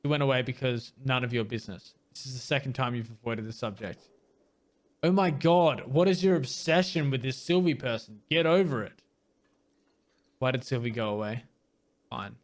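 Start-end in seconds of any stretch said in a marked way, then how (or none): 5.0-9.0 higher, louder fed-up rant; annoyed manager snapping at the detective; exasperated outburst. Acted. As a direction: lose patience with a nosy cop. Manager exasperated by Sylvie questions.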